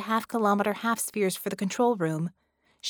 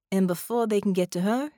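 usual speaking voice

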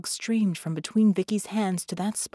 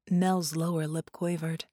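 usual speaking voice

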